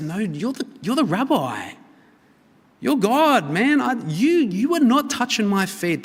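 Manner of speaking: a disciple aghast, pushing Jesus away; Peter protesting excitedly. Retold or imitated. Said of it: "but he's against".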